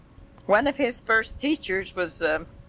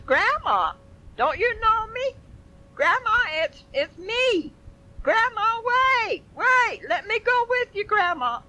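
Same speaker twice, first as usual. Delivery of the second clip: put-on pleading child. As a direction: call after her like a desperate small child. Boy begs woman who ignores him.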